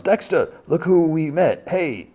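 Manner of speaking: high, bright call across the room; excited hostess calling out a greeting; cheerful yell. Retold or imitated. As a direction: call him over happily, voice up high. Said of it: "Blessing yells".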